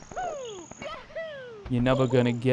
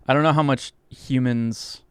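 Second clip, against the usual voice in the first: normal talking voice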